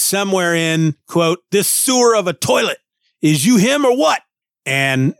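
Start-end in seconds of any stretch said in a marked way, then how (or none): 1.5-4.2 high, gruff Brooklyn tough-guy robot; a tiny robot doing a New York bruiser; Ben Grimm. Acted. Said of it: "associate that with Ben Grimm".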